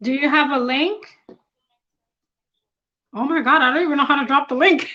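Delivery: ordinary talk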